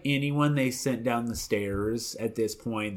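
ordinary talk, nothing marked

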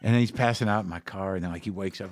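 ordinary talk

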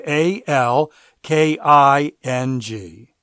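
"A L K I N G" letter by letter, slowly; spelled out. Shown as a demonstration. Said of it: "promo code W".

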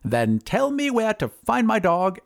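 high, slow wizard's demand; drawn-out, high-pitched bargaining. Acted. Wizard demands his dog.

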